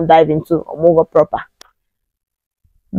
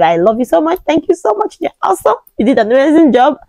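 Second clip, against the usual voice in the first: much higher, gushing gratitude; gushing thanks; 8 semitones higher than usual. Real, not acted. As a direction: squeal your thanks to the doctor. Thanking her doctor by name.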